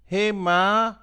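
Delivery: put-on high, loud scared child calling; scared cry. A narrator's reading. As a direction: cry out to mom in fright. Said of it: "cry out to my mother".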